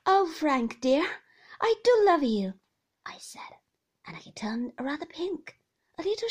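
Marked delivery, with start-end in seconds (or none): 0.1-2.6 laughing affection. A narrator's reading. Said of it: "I burst into laughter".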